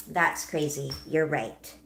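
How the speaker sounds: ordinary delivery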